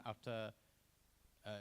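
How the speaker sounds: ordinary talk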